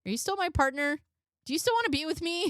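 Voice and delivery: mock needy girlfriend. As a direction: act out the clingy text.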